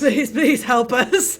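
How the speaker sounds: mock pleading. Begging game doctor.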